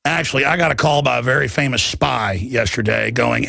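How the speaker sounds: normal talking voice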